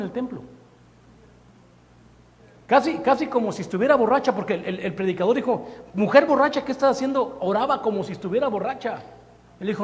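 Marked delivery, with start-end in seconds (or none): 5.9-7.4 loud, high rebuke; scolding shout. Retold or imitated. Priest scolding Ana in the temple, in a loud, high rebuke. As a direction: bark it at the praying woman.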